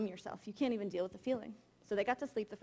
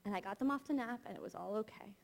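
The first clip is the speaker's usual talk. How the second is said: ordinary talk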